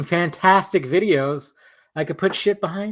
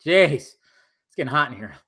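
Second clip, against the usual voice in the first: normal talking voice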